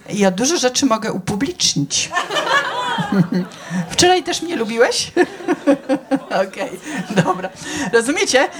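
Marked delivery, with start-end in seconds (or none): 2.2-3.9 high laugh. Real, not acted. Audience banter, laughing.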